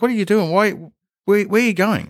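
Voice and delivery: high-pitched mock interrogation; jokey scolding. Model gives him shit as he passes, jokey scolding. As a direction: imitate her teasing him with questions. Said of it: "in a jokey kind of way".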